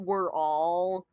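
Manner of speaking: normal talking voice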